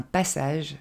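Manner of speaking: the second PASSAGE as "PASS-edge", stressed up front; slow model of English PASSAGE; correct English pronunciation. Shown as a demonstration. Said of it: "va se dire".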